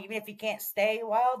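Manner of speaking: usual speaking voice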